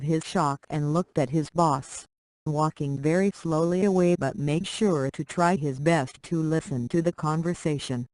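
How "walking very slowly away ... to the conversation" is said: usual speaking voice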